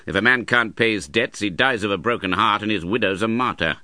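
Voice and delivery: put-on scornful old uncle. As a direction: sneer it like a hard old miser. Ralph voiced throughout.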